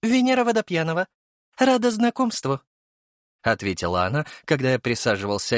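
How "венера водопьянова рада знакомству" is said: high female character voice; quick high-voiced introduction; young woman introducing herself. A narrator's reading. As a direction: answer brightly, pitched high.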